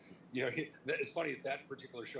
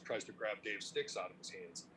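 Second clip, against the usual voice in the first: usual speaking voice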